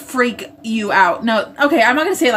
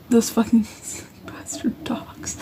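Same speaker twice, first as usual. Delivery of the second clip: hushed, breathy swearing with a sigh and a laugh; whispered curse; 7 dB quieter, slower than usual. Real, not acted. Cursing the cartoon dogs under breath.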